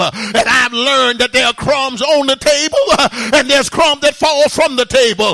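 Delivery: loud, rhythmic sermon delivery with uh breaths; fiery preaching. Acted. Preacher urging the church to call on God, fiery preaching. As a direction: preach it hard to the congregation.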